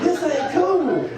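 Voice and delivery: half-joking fear as the buck stiffens; laughing mock alarm. Buck about to charge.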